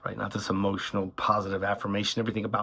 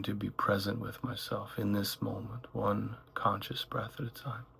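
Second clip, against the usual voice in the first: calm meditation voice, gradually softening; unhurried phrases with long silences between them; guided meditation; 5 dB quieter than usual. Acted. Guide leading listeners through one breath at a time, guided meditation.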